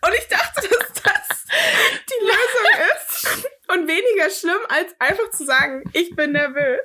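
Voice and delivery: embarrassed laugh squeezing the words high; amused disbelief. Real, not acted. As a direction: tell it through rising giggles. Confesses her silly excuse, cracking up.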